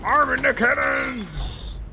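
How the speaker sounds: loud, slow battle cry; loud shout. Acted. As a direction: bellow it across the deck. Ship battle, calling orders.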